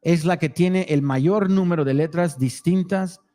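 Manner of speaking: reading the Spanish text aloud; steady Spanish read-through; reading foreign language. Real, not acted. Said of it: "read it in Spanish".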